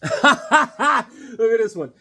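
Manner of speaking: ordinary delivery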